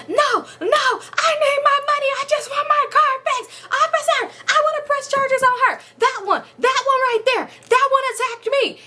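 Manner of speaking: high squealing mock voice, frantic; mock frantic woman. Mama resisting arrest begging officer, high mock shriek. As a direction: shriek it like a cartoon of her panic. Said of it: "she started going crazy".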